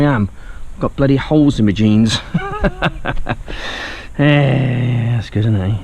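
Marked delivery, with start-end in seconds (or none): 2.2-4.1 high, bursting laugh at himself; real laugh. Real, not acted. Seller laughing at his own posh claim, real laugh.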